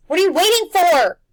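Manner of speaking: high, loud exasperated yell. A narrator's reading. Old woman yelling at the boy by the mist, exasperated. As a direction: shout at the dawdling boy. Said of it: "Missus Griffin yelled".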